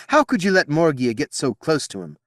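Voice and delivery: loud, high reproachful question; sharp rebuke. Acted. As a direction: demand an answer from him. Queen rebukes Merlin.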